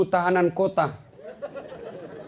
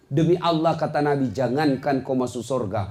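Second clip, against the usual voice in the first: ordinary delivery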